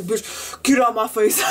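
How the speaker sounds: retold angry snap, building in force; mock Hulk outburst. Student retelling her blow-up at the teacher, mock Hulk outburst. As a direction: act out blowing up, half in fun. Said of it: "It was a fucking Hulk moment".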